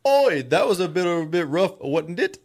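high, cheeky bad Australian accent; hammed-up Aussie diver shrugging it off. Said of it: "my Australian accent's terrible".

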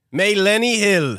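slow, drawn-out Millennial in a strange accent; mock accent, slow; odd accent. Shown as a demonstration. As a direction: stretch Millennial out in a put-on accent. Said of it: "What accent is that?".

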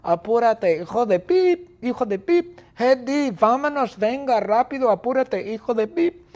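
high, loud relentless nagging; shrieky high-pitched hollering; impatient shouting. Acted. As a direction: yell at a slowpoke to hurry up. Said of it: "Bertita grita".

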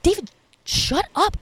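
high, slowed mock panic; panicked hush. Panicked denial to friend.

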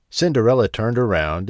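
plain reading voice

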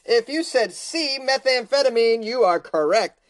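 game show host. Acted. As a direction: announce the winner like a host. Host reveals answer.

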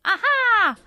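high loud mock yell; mock scream. Shown as a demonstration. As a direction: shout a sudden triumphant Aha. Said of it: "me screaming".

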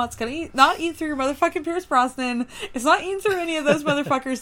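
ordinary talk

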